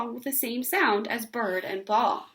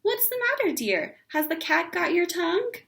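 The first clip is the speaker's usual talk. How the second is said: gushing neighbor; 8 semitones higher than usual. A narrator's reading. Neighbor cooing at Ramona in a high voice. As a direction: coo at the little girl.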